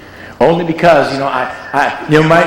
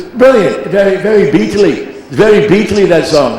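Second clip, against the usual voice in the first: higher, gushing mock [name]; [name] impression; 7 semitones higher than usual. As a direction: rave about it as [name]. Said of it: "He goes".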